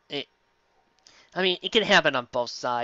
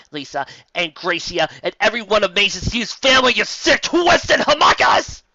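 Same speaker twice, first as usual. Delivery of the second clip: hammed-up furious shout; 4 semitones higher, 7 dB louder than usual. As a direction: spit the insult out like a cartoon hero. Cursing the villain Envy.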